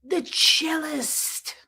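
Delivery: mock the ex announcing his jester name slowly; pompous ex-husband. Host mimics her ex-husband at restaurants, pompous and slow. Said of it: "And he'd go".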